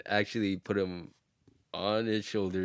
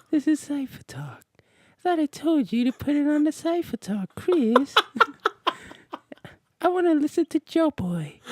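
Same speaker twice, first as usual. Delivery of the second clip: [name] voice. Retold or imitated. Signing off the podcast, high falsetto [name]. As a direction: plead and scold in a high pop-star falsetto. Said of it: "lead us out with that voice".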